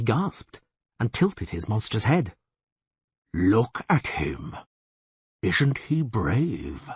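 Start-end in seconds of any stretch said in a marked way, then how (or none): 3.3-6.9 slow, drawn-out monster sarcasm; mocking creature voice. A narrator's reading. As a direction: taunt him slowly as the monster. Monster figure mocking the Witcher.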